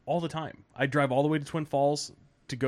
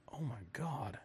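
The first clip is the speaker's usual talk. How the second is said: hushed shock; 14 dB quieter than usual. Retold or imitated. Doctor shocked at swelling.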